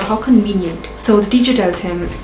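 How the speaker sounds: ordinary delivery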